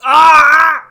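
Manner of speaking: very high, loud cry of pain; pained scream. Acted. Villain crying out in pain, very loud. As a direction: scream as the spikes hit.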